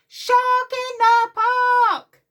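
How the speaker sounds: very slow, high shouted cry; big shout. Acted. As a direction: bellow it fit to bust. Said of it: "He shouts".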